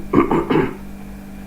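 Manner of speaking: sharp involuntary hiccup; a real hiccup jolting out. Real, not acted. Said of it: "You notice I'm hiccuping".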